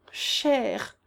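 plain reading voice

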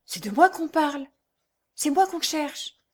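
high, frightened voice; stammering terror. A narrator's reading. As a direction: say it as a hunted woman gripped by dread. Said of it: "bégaya-t-elle avec une indicible épouvante".

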